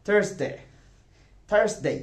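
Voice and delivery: Thursday as "THURS-day" with an "er" vowel; corrected Thursday, first syllable stressed; correct pronunciation demo. Shown as a demonstration. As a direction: model Thursday emphatically, r-coloured. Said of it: "আমার সাথে বলো".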